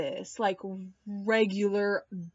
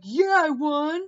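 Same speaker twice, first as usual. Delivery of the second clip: higher, louder mock insistence; defiant claim; 6 semitones higher, 6 dB louder than usual. Josh insisting to Mark he won, higher louder mock defiance. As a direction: insist you won, loudly. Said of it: "Josh was like".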